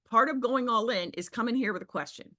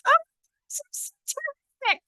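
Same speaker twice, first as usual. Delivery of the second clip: much higher, breathless mock gushing; gushing caller. Coach imitating a caller who gushes for minutes. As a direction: ham up the endless gushing praise. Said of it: "They go on for another two minutes".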